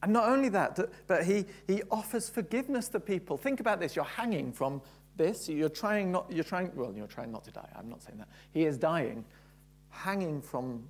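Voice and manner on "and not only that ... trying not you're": higher, louder preaching voice; impassioned preaching. Acted. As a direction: drive the point home to the congregation. Preaching at full force.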